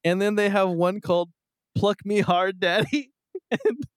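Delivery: high squealing laughter through words; can barely get it out for laughing; laughing hard. Real, not acted.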